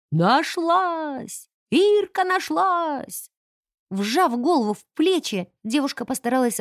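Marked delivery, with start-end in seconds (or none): 0.1-3.3 wailing shout. Acted. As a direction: cry it out to the whole building. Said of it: "заголосила на всё здание".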